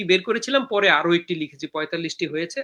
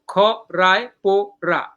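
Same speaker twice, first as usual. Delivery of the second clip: খ, রায়, পো, রা as four separate beats; syllable by syllable; slower than usual. Shown as a demonstration. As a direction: pause after each of খ, রায়, পো, রা. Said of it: "এটা হলো একটা পর্ব".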